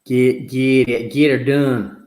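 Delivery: Texan drawl. Retold or imitated.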